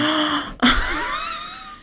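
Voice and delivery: high sharp gasp; shocked gasp. Real, not acted. Shocked at porn joke.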